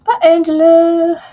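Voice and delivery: calling out. Real, not acted. Calling goodbye to someone leaving.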